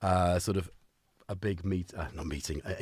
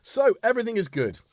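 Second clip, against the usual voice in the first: hyper inner voice; 11 semitones higher, 4 dB louder than usual. Retold or imitated. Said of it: "I thought".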